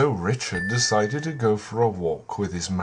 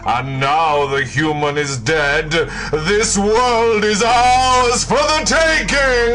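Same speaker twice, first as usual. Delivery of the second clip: rising louder and higher; gloating villain; 13 semitones higher, 10 dB louder than usual. Acted. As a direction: build into triumphant evil boasting. Villain reveals his plan.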